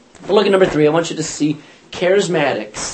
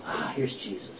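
much softer, hushed recognition; hushed aside; 6 semitones lower, 15 dB quieter than usual. Retold or imitated. Judas quietly picks out Jesus for the mob, hushed. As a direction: point him out quietly in the dark.

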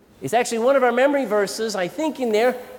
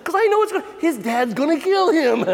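laughing squeal; 7 semitones higher than usual. Retold or imitated. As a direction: squeal through laughter, can't bear to watch. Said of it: "and I'm going".

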